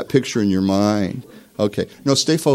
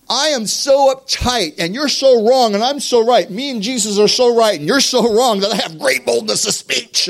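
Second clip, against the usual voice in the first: mock uptight rant; 12 semitones higher, 5 dB louder than usual. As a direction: rant like you and Jesus are right, voice way up. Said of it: "As veins pop out of my neck".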